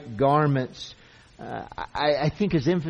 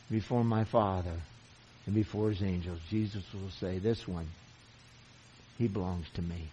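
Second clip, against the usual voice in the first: hushed reverence; 6 semitones lower, 5 dB quieter than usual. Acted. Preacher imagining Jesus claiming a believer, hushed awe. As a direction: let it fall to a hush of wonder. Quiet awed reading.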